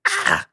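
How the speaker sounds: tiny high-pitched mock scream; very high, short mock shriek; imitated child's scream. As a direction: squeal like a screaming child, kept small. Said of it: "just screaming. I hear him".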